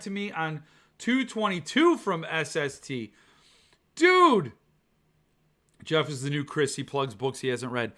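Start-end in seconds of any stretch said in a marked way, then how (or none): 4.0-4.5 indignant dude. Real, not acted. Reacting to unsent review books.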